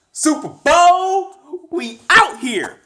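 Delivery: very high, loud, slow frat bro yell; hype shout. Real, not acted. Signature sign-off hype call.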